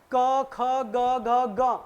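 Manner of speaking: क ख ग घ ग spoken very slowly, each letter apart and felt in the throat; slow letter demo. Shown as a demonstration. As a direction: sound out क ख ग घ ग slowly for the class. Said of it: "इसमें देखो".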